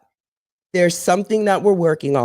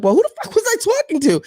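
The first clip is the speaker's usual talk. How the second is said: exasperated; much faster than usual. Retold or imitated. Put on hold by 911.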